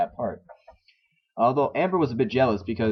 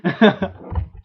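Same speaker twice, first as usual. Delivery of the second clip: high burst of laughter; loud laugh; 8 semitones higher, 6 dB louder than usual. Real, not acted. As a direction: cackle at the joke. Laughs at own joke.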